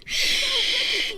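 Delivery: librarian shushing whisper. Acted.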